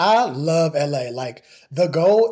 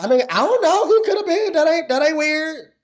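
put-on squeaky high girlfriend voice, unsure; high mock friend voice; 13 semitones higher than usual. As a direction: pitch way up, playing innocent. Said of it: "She was like".

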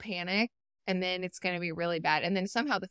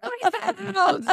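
mock alarm, jumping much higher; manic brain voice; 13 semitones higher, 8 dB louder than usual. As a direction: play your frantic ADHD brain pinging. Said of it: "And my A D H D was like".

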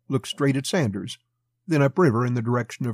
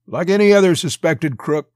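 loud, higher-pitched, biting jab; 6 semitones higher, 8 dB louder than usual. A narrator's reading. Young customs officer to a suspected crook, biting. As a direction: bite it out at a suspect. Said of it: "said bitingly".

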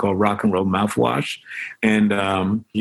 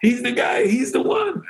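much higher, shouting "He's the one"; excited fan shout; 13 semitones higher than usual. Retold or imitated. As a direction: point him out with excitement. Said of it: "a guy look at me and go".

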